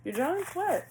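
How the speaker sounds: high, incredulous questioning; real irritation. Real, not acted. Reader questions an unclear card message, irritated and incredulous. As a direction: fire off baffled questions, pitched high. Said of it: "I don't know why I'm getting so irritated".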